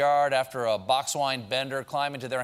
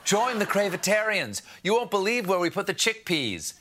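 usual speaking voice

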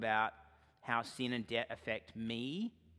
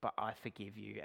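passive-aggressive forgiveness; 4 semitones lower, 8 dB quieter than usual. Retold or imitated. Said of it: "passive-aggressively going to somebody and saying".

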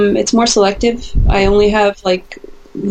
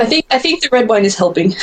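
laughing; 6 semitones higher than usual. Real, not acted. Laughing at own joke.

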